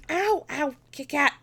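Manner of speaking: loud, high cry of pain; pained yelp. Real, not acted. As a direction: yelp and scold the cat. Cat's claws dig into her.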